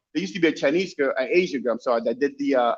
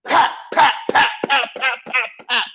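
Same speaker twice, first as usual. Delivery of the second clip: imitated gunshots; 8 semitones higher, slower than usual. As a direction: spit out each pop like a shot.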